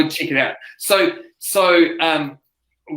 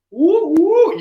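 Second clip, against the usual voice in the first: high, mock cheering whoop; 13 semitones higher, 5 dB louder than usual. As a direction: whoop it up like an eager crowd. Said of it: "this person rolls out and they're just like".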